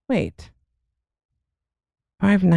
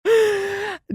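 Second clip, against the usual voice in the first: a short laugh that jumps up high; 13 semitones higher than usual. Real, not acted. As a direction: laugh at your own story. Laughing at the kangaroo pizza.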